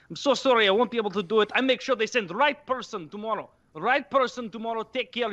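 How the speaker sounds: the Bosnian repairman upset at the damage; agitated Eastern European accent. Retold or imitated. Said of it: "that Eastern European accent".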